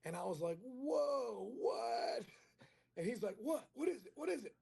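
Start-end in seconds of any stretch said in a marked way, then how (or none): 0.6-2.2 high, loud, drawn-out disbelief; stunned reaction. Retold or imitated. Comic re-enacts reading his date's text, stunned high outburst. 3.4-4.5 high-pitched excited questioning; eager demanding. Retold or imitated. Buddy begs to hear the text, high eager questioning.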